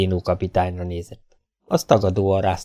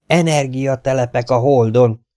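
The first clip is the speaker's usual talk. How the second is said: a louder, higher cry of excitement; excited exclamation; 5 semitones higher, 4 dB louder than usual. A narrator's reading. Mark, restless with excitement, cries out his discovery to Zénó. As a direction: proclaim it, feverish with excitement. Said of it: "kiáltotta".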